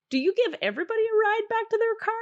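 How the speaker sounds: excited high question. Retold or imitated. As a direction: ask it bubbling up with excitement. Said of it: "I was like".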